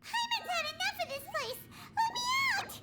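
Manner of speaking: very high, squeaky frantic wail; high-pitched trapped-and-fed-up wail; panicked tantrum. Acted. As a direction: cry out to be let go in a tiny high voice. Paimon panics, demands out.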